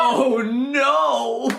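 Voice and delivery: panicked cry. Retold or imitated. Waiter realizing the couple is British, crying out. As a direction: yelp it out, half laughing. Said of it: "And I was like".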